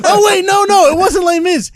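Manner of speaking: high, loud laughing correction. Real, not acted.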